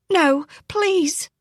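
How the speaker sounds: high, breathless gasp of alarm; gasped plea. A narrator's reading. Roberta grabs the captain's arm, gasping in alarm. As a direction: catch your breath and beg him to stop. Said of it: "she gasped".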